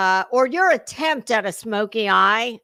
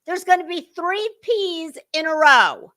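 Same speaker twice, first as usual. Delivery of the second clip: usual speaking voice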